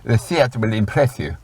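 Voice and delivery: put-on slow Spanish accent with lisped s. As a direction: lisp "impress" as impreth in Spanish accent. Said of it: "You have to do the lisping, the intentional lisping".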